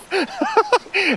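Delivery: high breathless joyful laugh; giddy laugh. Real, not acted. Thrilled after catching trout.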